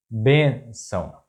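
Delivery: bênção slowly split, bên - ção; syllable by syllable. Shown as a demonstration. Teacher breaking down an example word, bênção slow in syllables.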